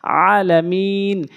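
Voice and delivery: আলামিন opened with a squeezed throat ain, vowels held; slow Arabic recital of আলামিন with ain; ain, not hamza. Shown as a demonstration. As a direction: draw out আলামিন from a tight mid-throat start. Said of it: "আমরা আইন এনে উচ্চারণ করব".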